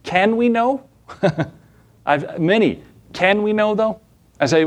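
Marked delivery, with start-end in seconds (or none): 0.0-0.8 high, doubting question, rising at the end; skeptic's high-pitched query; mock skeptic. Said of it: "what do they say?". 3.1-4.0 high-pitched imitation of a doubter; doubting, raised-pitch query; mock skeptic. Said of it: "what do they say?".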